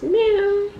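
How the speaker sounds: high playful meow; cat meow. Pretend. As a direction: meow like a kitten for the baby. Mom mimics cat again.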